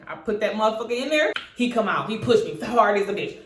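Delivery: ordinary talk, nothing marked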